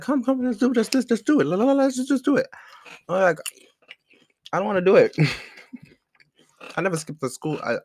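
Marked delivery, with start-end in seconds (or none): 0.0-2.5 much higher and louder, a whiny mock coax; pleading friend. School friend urging him to skip class, high whiny coaxing. 4.5-5.2 higher and louder, a childish mock protest; whiny refusal. Refusing his friend's push to skip class, loud whiny no.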